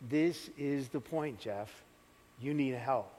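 low, soft and slow, with pauses; imitated divine whisper. The Lord telling Jeff he needs help, hushed near-whisper. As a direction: let each phrase fall hushed and deliberate. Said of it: "the Lord's whispering to me".

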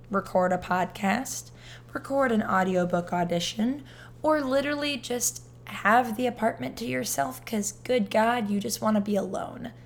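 loud, upbeat mock commercial voice; ad read. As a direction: sell it like a radio ad.

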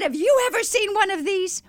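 high, breathless-with-enthusiasm question; excited. Retold or imitated. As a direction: pitch it high, like thrilling news. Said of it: "as an excited I could".

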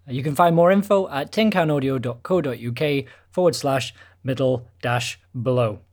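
ordinary talk, nothing marked

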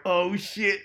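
high, loud startled oh shit; caught out. Retold or imitated. Ed spotting ten coppers watching, loud startled blurt. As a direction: blurt it as the police stare. Sees police watching him paint.

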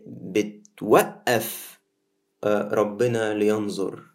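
ordinary talk

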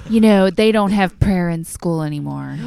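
put-on loud whiny Wisconsin mom accent; complainy Midwestern mom voice. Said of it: "my Wisconsin accent".

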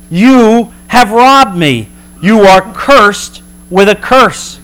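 God accusing Israel, slow and stern; stern slow rebuke. Acted.

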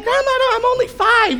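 put-on the boy protesting to grandma in a high whine; whiny child. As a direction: protest like a five-year-old. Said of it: "I'm like".

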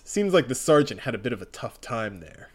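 normal talking voice

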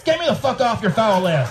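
reading an angry unsubscribe email, amused mockery; angry reader quote. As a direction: read his angry email with a laugh. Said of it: "Marty Paris".

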